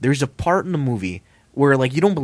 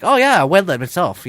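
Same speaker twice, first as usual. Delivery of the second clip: raised, loud mock Chinese accent; badly faked Chinese accent, pitched up; terrible Chinese accent; 7 semitones higher, 7 dB louder than usual. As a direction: put on a clumsy Chinese accent. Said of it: "terrible Chinese accent, I'm sorry".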